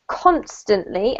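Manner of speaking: constantly, stressed on CON; CONstantly, slow and clear; stress on first syllable. Shown as a demonstration. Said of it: "emphasis on the first syllable".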